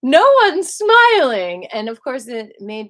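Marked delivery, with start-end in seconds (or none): 0.0-1.7 high, loud, slow bright announcement; sing-song call to a grumpy queue; cheery call out. Retold or imitated. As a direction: call it out to lift the whole line. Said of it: "And I just said".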